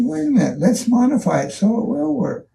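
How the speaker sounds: retold counter-offer, pitched very high; eager persuasion. Team leader answers the doubting engineer, high and persuasive. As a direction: jump in high to win over a doubter.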